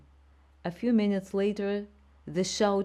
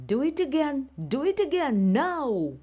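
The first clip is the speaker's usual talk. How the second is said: shouting teacher; 9 semitones higher, 5 dB louder than usual. A narrator's reading.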